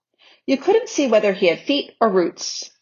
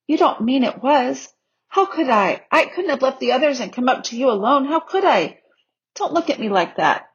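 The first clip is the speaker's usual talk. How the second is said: put-on high, upset child's protest; pleading protest; 6 semitones higher than usual. A narrator's reading. Lucy defending herself to the lion, pleading. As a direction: protest your innocence in a raised, pleading pitch.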